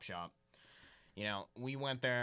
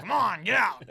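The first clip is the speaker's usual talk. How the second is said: put-on higher, louder gruff yell at kids; grumpy old fisherman; 9 semitones higher, 13 dB louder than usual. Old fisherman chasing kids off the docks, hammed-up angry yell. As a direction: shoo the kids off the docks like a cranky old man. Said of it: "Grumpy crab fisherman".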